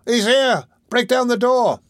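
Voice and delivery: high, urgent shouted alarm; shrieking. A narrator's reading. Boy alerting the crowd to the murderer, shrieking. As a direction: shriek it high to those outside. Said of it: "shrieked the boy in a voice that rent the air".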